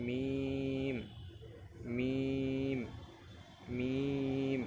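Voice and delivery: মিম with a long, level ee; recitation demo. Shown as a demonstration. Teacher models the letter for learners, drawn-out recitation. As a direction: hold each মিম on one steady note. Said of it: "আরেকবার পড়ি আমরা".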